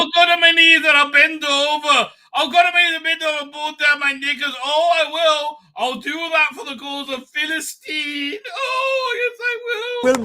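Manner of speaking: high, loud, hammed-up parody of the protester; mock him in a high, gushing voice; mocking impression. Mocking the pro-Palestine marcher clip.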